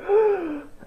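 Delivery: high shocked gasp. Retold or imitated. Blonde vampire sees the child, high mock gasp. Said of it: "she's like".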